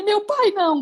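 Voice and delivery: put-on high whiny child's protest; mock scared kid. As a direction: beg like a scared boy. Criança assustada imitada. (criança com medo, voz aguda)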